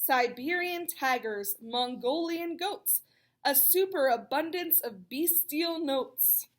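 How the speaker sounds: lilting rhyming read-aloud; storybook rhyme voice; reading to children. A narrator's reading. Reads rhyming picture book.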